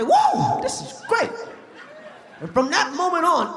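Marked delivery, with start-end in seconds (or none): 0.0-1.3 squealing high with delight; a wildly high thrilled squeal; high mock squeal of wonder; amazed.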